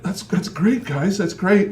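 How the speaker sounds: feigned enthusiasm. Retold or imitated. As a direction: act delighted while bluffing.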